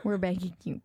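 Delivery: normal talking voice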